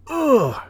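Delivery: high strained "ugh" groan; involuntary groan. Shown as a demonstration. Showing viewers the groan you make bending over. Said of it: "you just kinda go".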